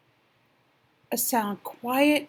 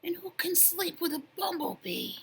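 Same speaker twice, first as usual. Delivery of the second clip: soft breathy whisper; 8 semitones higher, 7 dB quieter than usual. Acted. Sleepy rabbit complaining about the bee, whispering. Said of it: "all he could do was whisper".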